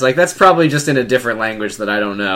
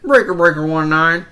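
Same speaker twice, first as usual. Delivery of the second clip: over walkie talkie; 6 semitones higher than usual. Acted. CB radio call.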